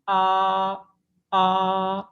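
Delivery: आ held level and steady; flat first tone. Shown as a demonstration. Teacher demonstrates a flat first-tone आ. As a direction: sing a long, even आ. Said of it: "आ की आवाज़ है वो फिर straight निकलेगी".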